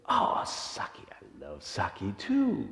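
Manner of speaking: slow, drawn-out delight; acted old man's happy relish. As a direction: savour it with friendly enthusiasm. Old man delighted by answer.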